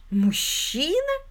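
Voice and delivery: very slow, high, drawn-out question; high, lingering echo of the question; amused incredulity. A narrator's reading. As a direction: stretch the word upward in mocking disbelief. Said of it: "Мэри Уитакер внезапно рассмеялась".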